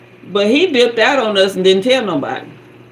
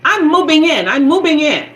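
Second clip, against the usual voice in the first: insistent tenant; 8 semitones higher, 4 dB louder than usual. Retold or imitated.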